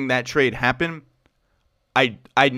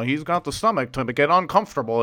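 odd voice. Real, not acted. Tired host slipping into an odd put-on voice, half joking. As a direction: slip into a silly tired voice. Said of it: "I don't know why I'm doing this voice".

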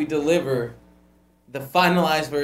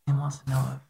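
soft, low off-mic murmur; hushed, low, hesitant aside; discreet aside; 4 semitones lower, 10 dB quieter than usual. Real, not acted. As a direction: relay it privately, under your breath. Relays private phone message quietly.

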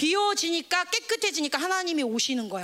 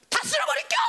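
high shouted threat; hammed-up shrill outburst; raging wind; 11 semitones higher, faster than usual. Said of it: "막 바람이".

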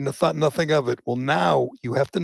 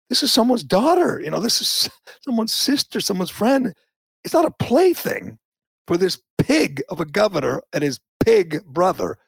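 outraged; 6 semitones higher than usual. Real, not acted. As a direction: build it into real outrage. Outraged defense of victims.

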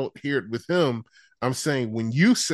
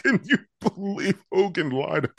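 slow mock-laughing delivery; forced corny laughing; 4 semitones higher than usual. Host mocks Brian laughing along with Cornette, forced laughter. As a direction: imitate someone forcing laughs through the question. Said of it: "it's so forced and corny".

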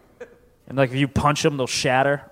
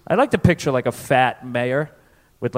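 ordinary delivery

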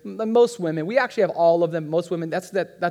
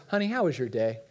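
loving wife; 6 semitones lower, 5 dB quieter than usual. Retold or imitated. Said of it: "like a loving wife".

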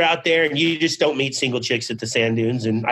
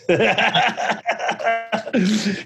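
long, higher-pitched run of laughter; real laugh; 8 semitones higher than usual. Real, not acted. Storyteller recalling the sister's phone call, amused laugh. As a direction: crack up at your own story.

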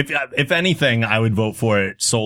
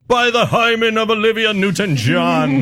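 Anchorman line; 11 semitones higher than usual. Retold or imitated. Announcing the winning quote, hammed-up grand declaration. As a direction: declaim it like a pompous newsman. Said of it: "I don't know how it was delivered".

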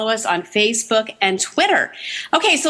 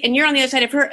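usual speaking voice

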